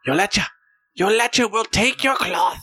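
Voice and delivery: cackling wicked witch. Acted. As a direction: shriek it out like a mad witch. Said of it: "she is cackling madly".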